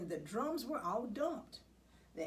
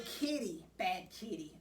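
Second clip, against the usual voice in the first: ordinary delivery, nothing marked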